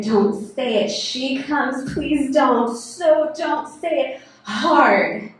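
building to a high, loud cry; desperate plea. Acted. Pleads against voices, climax.

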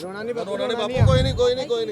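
hushed, soothing words to a crying elder; soft comforting. Real, not acted. Soothing the tearful old father, softly.

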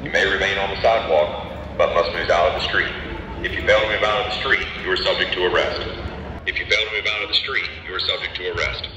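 fast, deep recorded loudspeaker warning; police loudspeaker. Real, not acted. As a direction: blare it through a loudspeaker.

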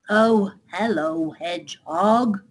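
slow, drawn-out otter; reply slowly as a chilly otter. Acted. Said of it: "replied the otter".

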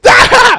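loud, high burst of mock laughter. Glaswegian laughs in the traveller's face, mocking laugh. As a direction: imitate someone laughing right in your face. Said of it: "just, like, laughed in my face".

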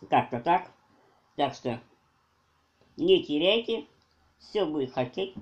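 talking with food in mouth; muffled chewy speech; mouth full, chewing. Real, not acted. Seen 1: Bread in hand, cheek bulges and jaw works between bites throughout.

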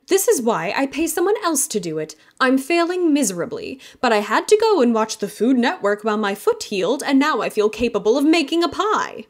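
high, loud, exasperated woman's voice; a polished aunt fussing over her failed pie; flustered aunt. Acted. Aunt Shelby frets over pie.

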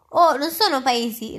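ordinary delivery, nothing marked

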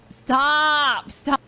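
loud, high mock protest; embarrassed protest. Friend caught crying begs them to quit, loud mock protest. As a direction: cry out as if caught tearing up.

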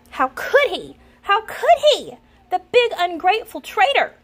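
high, loud indignant fuming; a jealous friend fuming at a traitor. A narrator's reading. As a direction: spit out the betrayal. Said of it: "Bambolona fumed".

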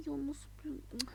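slow hushed whisper. Real, not acted. Thinking about the jump, a slow whisper. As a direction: trail off in a whisper.

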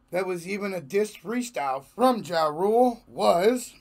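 normal talking voice

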